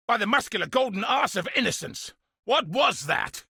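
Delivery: sudden high startled exclamation; startled oath. Acted. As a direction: blurt it out, caught off guard.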